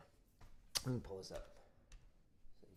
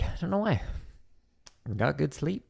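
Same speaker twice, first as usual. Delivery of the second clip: ordinary talk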